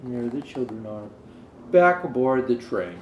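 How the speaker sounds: ordinary delivery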